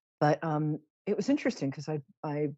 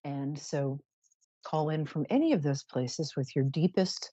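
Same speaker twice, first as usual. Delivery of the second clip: usual speaking voice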